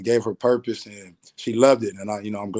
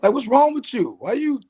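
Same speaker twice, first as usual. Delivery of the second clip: higher, exasperated pleading at mom; frustrated son; 7 semitones higher than usual. Retold or imitated. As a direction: push back at her, voice climbing. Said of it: "I get a little frustrated at Mom's".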